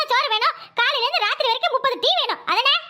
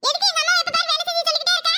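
higher, mocking kid's voice; cheeky boy voice; 5 semitones higher than usual. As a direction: sass the grandfather like a bratty boy. Boy argues with grandfather.

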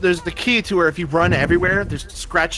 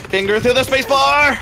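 excited shout; 9 semitones higher, 5 dB louder than usual. Real, not acted. Cheering teammate on.

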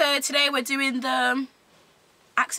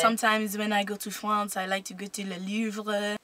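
put-on French accent; 4 dB quieter than usual. Shown as a demonstration. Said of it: "we haven't put down French".

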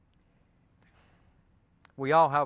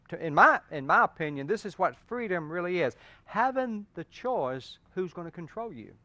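ordinary delivery

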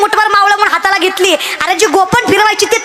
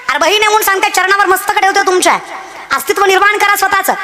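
ordinary delivery

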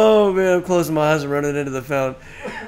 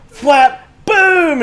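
loud high flap and boom; explosive retelling of a blow; imitated impact noises; 11 semitones higher, 7 dB louder than usual. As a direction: act out the hit in sound effects. Imitating the hit.

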